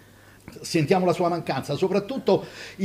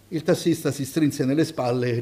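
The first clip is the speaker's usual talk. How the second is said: plain reading voice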